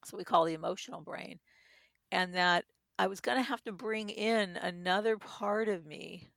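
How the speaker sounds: normal talking voice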